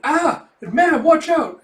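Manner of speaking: high, loud alarmed yell; warning shout. Acted. Porter warning the lady about to fall, alarmed shout. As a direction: cry out to stop an accident.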